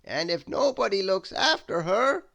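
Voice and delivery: high, slow, solemn wizard; wizard's voice. Acted. Wizard showing Mister Mean the past, solemn and high. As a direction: warn him gravely of what may come. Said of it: "replied the wizard".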